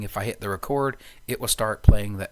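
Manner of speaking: usual speaking voice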